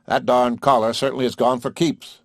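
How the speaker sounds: plain reading voice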